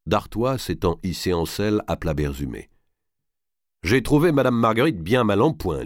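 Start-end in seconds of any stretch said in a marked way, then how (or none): 3.8-5.8 high, loud announcement for all to hear; raised, declamatory master's voice; commanding lord, projecting. Acted. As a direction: call it out with lordly authority. Robert calls out feigned news and orders.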